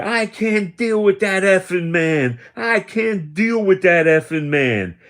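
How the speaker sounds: old man mocking a coworker's angry outburst, in a hammed-up furious voice; mimicked rage. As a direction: ham up her tantrum in the hallway.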